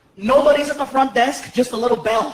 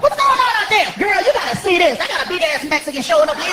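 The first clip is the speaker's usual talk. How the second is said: hollering clerk; 5 semitones higher than usual. Retold or imitated. Clerk hollers to coworker about the guest, high and sassy. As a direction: call to a coworker across the office. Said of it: "clerk calls to coworker".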